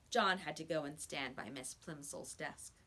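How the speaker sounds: usual speaking voice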